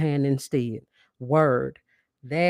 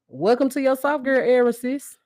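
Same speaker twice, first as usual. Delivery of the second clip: bright sign-off; 8 semitones higher, 4 dB louder than usual. Acted. Closing catchphrase to listeners.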